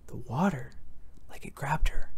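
put-on hushed kids gossiping, fading to a whisper. A narrator's reading. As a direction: breathe it as a secret. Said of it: "Some of the kids were whispering".

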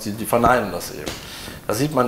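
normal talking voice

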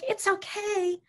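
high, sing-song reassurance; placating cheer. Shown as a demonstration. Caregiver breezing in on someone sad, placating. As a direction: chirp a mismatched, too-sweet comfort. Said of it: "you come in, you're like".

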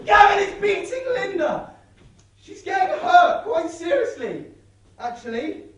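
loud, high yell settling down at the end; desperate shout. Acted. Linda shouting the full news across the road. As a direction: shout the alarm, then trail off.

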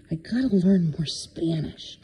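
whispered repeat. Real, not acted. Pet sitter confiding to the cat, whispered. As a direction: breathe the words out as a whisper.

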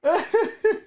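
excited call cracking into giggles; can't stop laughing. Real, not acted. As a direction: call it out while helplessly laughing. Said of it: "I can't stop laughing".

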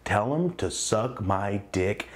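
slow, stretched-out delivery; dragged out. Retold or imitated. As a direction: drag every word out long. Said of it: "dragged out".

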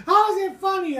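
high, loud indignant challenge. Retold or imitated. Coworker challenges him with an indignant, loud demand. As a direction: demand to know why it's funny. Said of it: "he goes".

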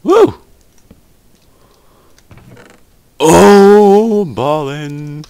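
high whooping cheer; excited cheer. Real, not acted. Joyful cheer at loot.

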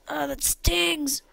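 slow, high-pitched cry of pain; acted pain. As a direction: draw it out as if stung. Burnt character cries in pain.

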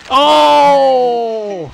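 a loud startled cry; startled shout. Real, not acted. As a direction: yelp as you get hit. Shout as avatar crashes.

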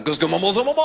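gibberish French climbing high at the end; mock French noises. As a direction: babble fake French with rising pitch. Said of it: "I'd just make French noises".

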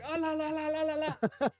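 high gibberish imitating Russian yelling; hammed-up foreign yelling; mock Russian yelling. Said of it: "yelling in Russian".

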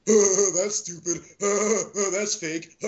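high, exaggerated guffawing heckler; mocking heckler. Mimicking the man who ruined the film, mocking laughing voice. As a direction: imitate an obnoxious moviegoer with braying fake laughs. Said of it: "all I fucking heard was".

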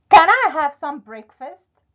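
loud, high, hopeful question; boy asking. Acted. Jack asks the big woman for breakfast, piping up. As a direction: pipe up boldly at the door.

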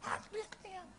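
high, soft squeaky mimicry; put-on thin little complaining voice; mock griping. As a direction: caricature a griper in a small high voice. Mimics a griping person, unlike Jesus.

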